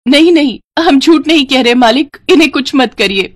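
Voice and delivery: high-pitched, urgent begging; frightened pleading. Acted. Villager begs landlord not to hurt them, frightened. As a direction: plead with the master for mercy.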